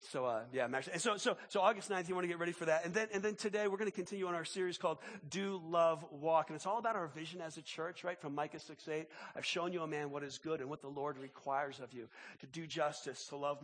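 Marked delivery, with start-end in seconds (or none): none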